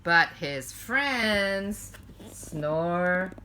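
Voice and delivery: slow, lilting bedtime-story narration; cozy picture-book reading, stretched and sing-song; storytelling read-aloud. A narrator's reading. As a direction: read it to children at bedtime, drawing out the lines.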